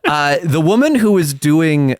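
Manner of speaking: normal talking voice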